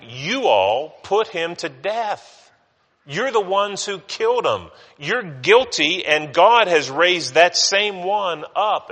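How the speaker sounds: preacher voicing Peter accusing the council, emphatic and slow; emphatic accusation. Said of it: "Peter and John were emphatic".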